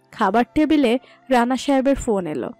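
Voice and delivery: ordinary talk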